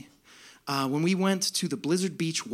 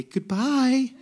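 gentle sing-song goodbye; 5 semitones higher than usual. Retold or imitated. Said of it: "gently waft them towards the slide and say".

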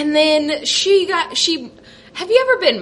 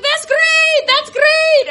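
excited nurse; 14 semitones higher, 4 dB louder, slower than usual. Retold or imitated.

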